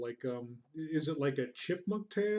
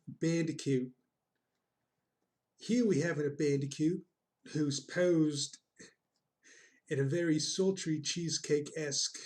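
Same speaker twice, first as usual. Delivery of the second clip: hammed-up Australian nature-show host; terrible Australian accent. Streamer hosting Down Under Safari, bad Australian accent. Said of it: "That is a terrible Australian accent".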